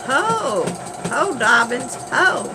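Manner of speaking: loud, high holler at a horse. Retold or imitated. Potter hollering at the car as if a horse. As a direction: yell ho to stop a runaway. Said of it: "he's hollering".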